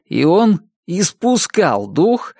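slow «испускал» said correctly now; corrected, still slow. Acted. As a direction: read «испускал дух» carefully, slowly. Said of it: "продолжил". (медленно, правильно «испускал дух»)

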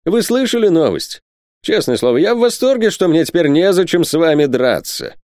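high-pitched, delighted news-breaking; excited exclamation. A narrator's reading. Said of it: "воскликнул он". (восторженно, высоким голосом)

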